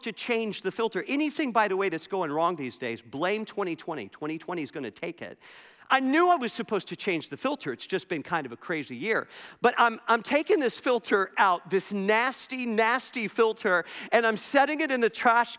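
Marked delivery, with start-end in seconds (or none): none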